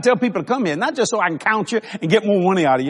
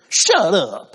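retold shut up; 3 semitones lower than usual. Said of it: "That's what she said".

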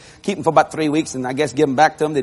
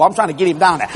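ordinary delivery, nothing marked

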